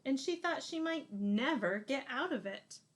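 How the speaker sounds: usual speaking voice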